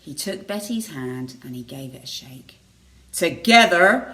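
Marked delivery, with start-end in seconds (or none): 3.1-4.1 pompous Reginald. Acted. Reginald speaking to Betty.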